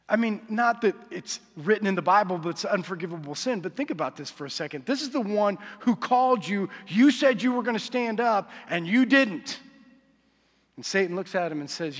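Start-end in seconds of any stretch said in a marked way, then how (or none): 4.8-9.6 louder, driving sermon build; fiery preaching. Acted. Preacher warning church about the enemy, loud and urgent. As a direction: press the warning home to the congregation.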